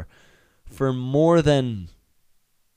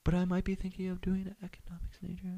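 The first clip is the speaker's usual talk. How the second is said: high, whispery, distant voice; muffled through metal door; 8 semitones higher, 10 dB quieter, faster than usual. Retold or imitated. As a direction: sound far away, still explaining behind the doors. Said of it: "still explaining through the metal door".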